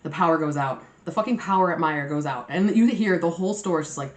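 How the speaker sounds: usual speaking voice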